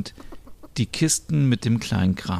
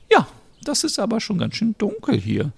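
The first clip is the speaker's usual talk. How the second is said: put-on very high, thin old-lady voice; old neighbour offering help, high and frail; elderly Merit; 11 semitones higher than usual. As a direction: play a sweet old neighbour.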